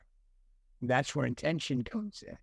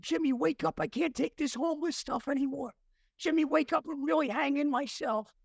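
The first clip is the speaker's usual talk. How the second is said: higher-pitched retold plea to wake up; mildly desperate, rousing a sleeping friend; urgent plea; 10 semitones higher than usual. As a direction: act out pleading with a sleeper.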